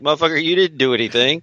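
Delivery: fast mock scolding of a kid; quick exasperated jab. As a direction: rattle it off fast at a proud kid. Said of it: "and I'm like".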